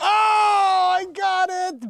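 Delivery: sudden high triumphant shout; excited shout. Real, not acted. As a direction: yell it out in relief. Finally fixes the audio.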